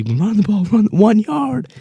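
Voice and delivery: high mock yell, stretching out the last words; half-shouting at the screen in memory; pleading yell at the TV, hammed up; retold yell at the TV. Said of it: "I was yelling at the T V".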